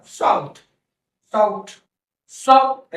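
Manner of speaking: "साउथ" with a full, open "au"; "साउथ" as an emphatic teacher's demo; big vowel sound. Shown as a demonstration. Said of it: "दो बड़ा sound है ओ के अंदर".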